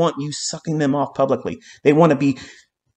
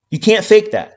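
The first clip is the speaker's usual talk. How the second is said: emphatic loud repeat; 7 semitones higher, 4 dB louder than usual. Real, not acted.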